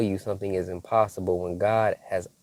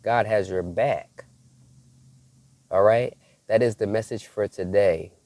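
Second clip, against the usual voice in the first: ordinary delivery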